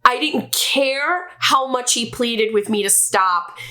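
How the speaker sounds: ordinary delivery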